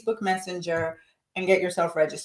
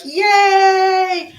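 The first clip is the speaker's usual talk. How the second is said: squealed high, loud cheer; excited yay; 12 semitones higher, 8 dB louder than usual. Retold or imitated. Brenda cheering at the yoga news, high squeal. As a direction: shriek it with delight. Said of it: "Brenda is like".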